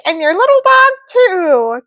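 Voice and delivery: high cackling witch menace; wicked witch threat. Acted.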